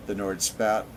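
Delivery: plain reading voice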